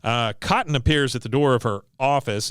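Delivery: normal talking voice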